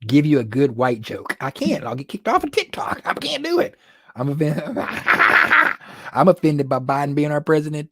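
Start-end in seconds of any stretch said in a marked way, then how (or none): 1.4-3.8 higher, mock-helpless whine about getting banned; mock whiny protest. Mock scared of being banned. 4.7-6.1 real laugh, high and loud; high burst of laughter. Real, not acted. Laughs at own joke.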